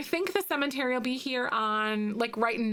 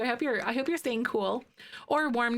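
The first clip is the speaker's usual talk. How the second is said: normal talking voice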